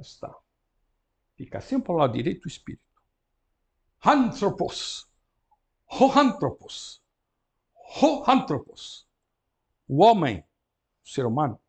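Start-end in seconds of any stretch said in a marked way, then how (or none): 4.0-9.1 ho ANthropos, very slow, higher and louder; slow careful model word. Shown as a demonstration. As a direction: drag the word out so they hear every syllable. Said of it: "Reparem, a palavra começa por vogal".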